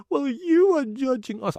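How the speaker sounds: high, slow, whiny mock voice; mocking whiny men. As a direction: mimic their whining complaint. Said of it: "maybe some of men will come".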